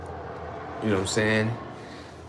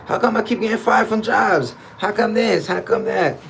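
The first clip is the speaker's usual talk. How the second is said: higher, puzzled whine; confused complaining; 8 semitones higher than usual. Retold or imitated. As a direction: complain like you don't get it. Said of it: "you were like".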